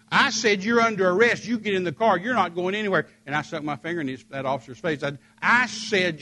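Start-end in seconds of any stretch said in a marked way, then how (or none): 0.1-3.1 higher, louder stern officer voice; a touch of an insistent policeman. Retold or imitated. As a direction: put your foot down as the cop. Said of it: "officer repeating arrest".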